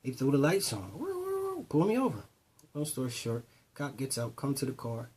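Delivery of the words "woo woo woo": high mock police siren wail; siren imitation. A Magnum pulls him over, mock siren.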